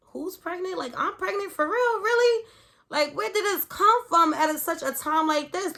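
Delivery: high, rising panicked disbelief; shocked disbelief. Retold or imitated. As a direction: freak out in disbelief. Retold shocked panic, high pitch.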